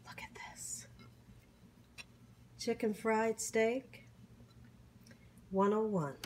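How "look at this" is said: soft, breathy whisper; hushed whisper to the viewers. Real, not acted. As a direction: lean in and whisper it. Whispering over the food.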